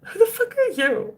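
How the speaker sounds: a hammed-up high "who the fuck are you"; retold outrage. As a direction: play your past self's outraged reply. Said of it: "And I was like".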